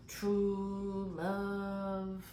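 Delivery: long drawn-out monotone movie quote; Wesley. Retold or imitated. Reader quoting Princess Bride after the chest press, drawn-out imitation. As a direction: stretch both words out slowly, imitating the film line. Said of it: "and he says".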